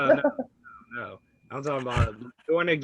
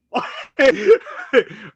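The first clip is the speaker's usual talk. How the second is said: big burst of laughter; sudden loud laugh; 13 semitones higher, 12 dB louder than usual. Real, not acted. Laughing mid-sentence.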